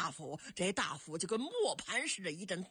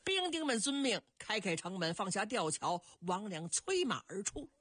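usual speaking voice

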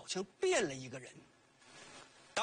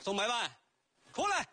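calling out; 3 semitones higher than usual. Retold or imitated. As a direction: summon the rich man out, yelling. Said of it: "这一喊".

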